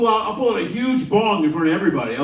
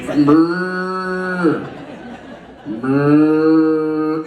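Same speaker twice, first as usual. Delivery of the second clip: drawn-out mock cow moo; cattle lowing; 2 semitones lower, 4 dB louder than usual. Passengers herded by a rope, lowing like cattle.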